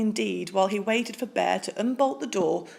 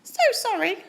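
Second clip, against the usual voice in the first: much higher squeaky mouse; a small bright-eyed mouse piping up; tiny mouse voice; 12 semitones higher, 4 dB louder than usual. Acted. Polite mouse asks Bear for cheese, squeaky high.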